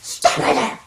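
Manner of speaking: alarmed inner voice, mostly whispered with a high cry; stomach's alarm voice. Retold or imitated. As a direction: play your panicked stomach yelling stop. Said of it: "my stomach and my brain going".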